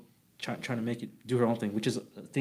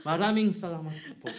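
Filipino accent, higher and brighter; 6 semitones higher than usual. Shown as a demonstration. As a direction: warm Filipino thanks, lifted. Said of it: "close it off with Filipino accent".

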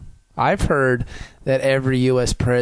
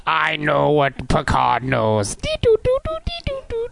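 toy robot voice. Pretend. Robot Picard speaks.

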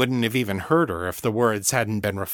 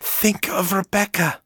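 higher, urgent pressing plea; desperate to break the spell; hissed; 8 semitones higher than usual. A narrator's reading. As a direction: Ava urging Danny. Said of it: "Ava hissed in her ear".

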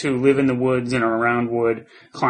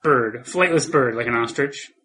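ordinary talk

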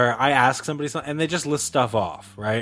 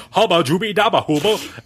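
higher, nonsense incantation in a put-on voice; mock voodoo healer; 6 semitones higher, 3 dB louder than usual. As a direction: chant gibberish like a hammed-up healer. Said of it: "one of those creepy voodoo healers".

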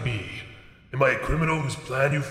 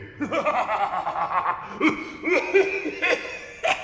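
evil laugh; 9 semitones higher than usual. Acted. Villain gloats.

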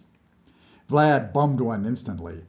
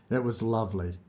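plain reading voice

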